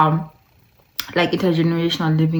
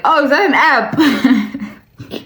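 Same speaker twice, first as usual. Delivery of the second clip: put-on higher, slowed, half-laughing kid voice; imitating a child; 7 semitones higher, 3 dB louder, much slower than usual. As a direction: play the confused little niece, lightly. Niece asks about the app.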